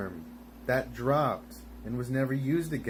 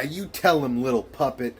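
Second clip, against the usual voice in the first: ordinary delivery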